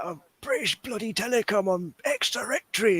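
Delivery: high, slow, softened take on an irate caller; angry BT caller. Retold or imitated. Friend quoting the video to the others, mock angry caller.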